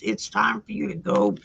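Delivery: ordinary talk, nothing marked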